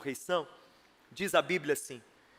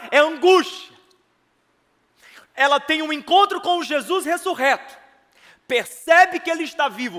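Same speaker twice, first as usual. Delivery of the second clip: high, loud, building sermon voice; impassioned preacher raising the voice; full-force preaching; 6 semitones higher, 7 dB louder than usual. Real, not acted. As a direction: build intensity phrase upon phrase.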